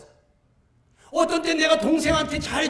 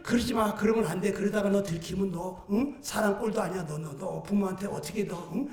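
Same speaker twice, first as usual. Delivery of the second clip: lightly voiced inner warning, lower; voice of conscience; 4 semitones lower, 6 dB quieter than usual. Conscience warning the would-be thief against stealing, lightly voiced admonishing. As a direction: warn yourself in a lowered, softer voice. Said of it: "양심에서는".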